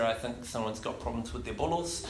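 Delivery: half-whispered secret in his ear; mock whisper. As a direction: lean in and murmur it. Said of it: "I whispered in his ear".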